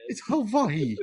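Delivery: soft, slow mock Hawaii as ho-VAH-hee. Co-host mimicking the cousin, softly, Hawaii as ho-VAH-hee. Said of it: "Did he come like".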